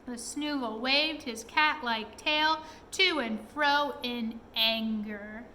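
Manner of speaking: ordinary delivery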